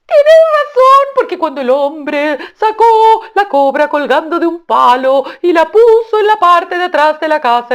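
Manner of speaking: very high, loud, slow bird voice, half sung; a gleeful tailorbird crowing praise; sing-song high loud shout. Acted. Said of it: "dialogue reply".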